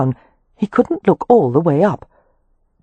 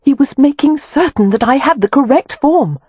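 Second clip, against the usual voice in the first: high, insistent explanation; exasperated explaining; 10 semitones higher, 3 dB louder than usual. A narrator's reading. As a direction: Wynne explaining to Kev, insistent. Wynne explaining to Kev.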